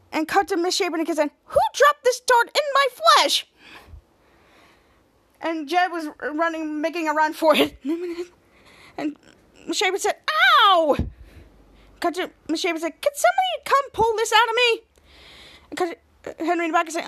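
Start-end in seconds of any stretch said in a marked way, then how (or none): 1.4-3.5 high, slow, indignant teacher voice; hurt teacher asks who threw the dart; outraged teacher. Retold or imitated. 10.3-10.9 sudden high squealed "ow" as the hurt teacher; teacher's shrill yelp of pain. Retold or imitated. Teacher hit by a dart. 13.0-14.8 high, whiny voice begging for help; teacher begs someone to pull the dart out; high pleading teacher. Retold or imitated.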